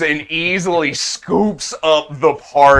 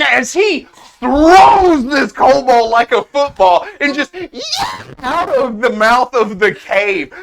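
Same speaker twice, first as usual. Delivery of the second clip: high, loud, laughing play-by-play; excited narration; 9 semitones higher, 5 dB louder than usual. Acted. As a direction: narrate the throw at full tilt.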